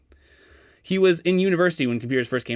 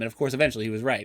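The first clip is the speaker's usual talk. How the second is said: usual speaking voice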